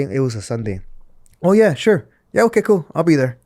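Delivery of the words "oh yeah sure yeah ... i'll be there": eager yes. Retold or imitated. Eagerly accepting the match offer.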